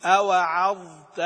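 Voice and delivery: slow and loud, the ظ in أوعظت sounded before the ت; don't merge the ظ of أوعظت into the ت; clear ظ. Shown as a demonstration. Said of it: "تبين الضاء بشكل واضح". (إظهار الظاء في أوعظت)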